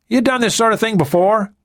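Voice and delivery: high, wide-eyed amazement; amazed. A narrator's reading. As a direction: ask it in disbelief. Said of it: "the man asked in amazement".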